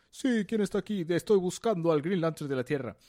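lightly voiced brash villain; a hint of boastful alien. Voicing Volfunga arriving. (villano fanfarrón, leve)

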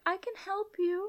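a teasing high fake-helpful tone; mock Damon. Damon voiced in recap.

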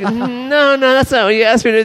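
retelling his own indignant reply to the friend; mock protest. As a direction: push back high and quick in disbelief. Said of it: "I'm like".

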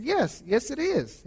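slow, high Middle Eastern caller impression; Middle Eastern accent. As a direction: imitate the caller's earnest yes in a rough Middle Eastern accent. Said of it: "in his Middle Eastern accent".

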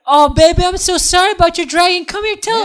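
high, loud mock-consoling lover voice; Jon sweet-talking. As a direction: coo hammed-up sympathy at full volume. Voicing Jon sweet-talking the grieving queen.

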